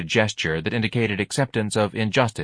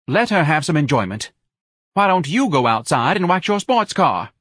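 high-pitched, louder wife's retort; indignant wife; 8 semitones higher, 5 dB louder than usual. A narrator's reading. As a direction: play the wife scolding her husband in a high voice. Wife snaps back at husband.

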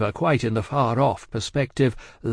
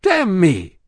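high, loud oath; blustering indignant outburst; angry cry; 7 semitones higher, 6 dB louder than usual. A narrator's reading. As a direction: explode in disbelief. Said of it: "cried Stryver".

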